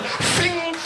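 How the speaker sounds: high mock swish. Mock sound of drawing gun.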